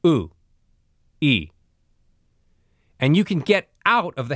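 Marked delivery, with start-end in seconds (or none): 0.1-1.5 "Oo" then "e" with the same rounded lips. Shown as a demonstration. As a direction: glide from "Oo" to "e" without widening. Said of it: "Listen to me".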